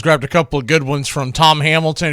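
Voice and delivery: normal talking voice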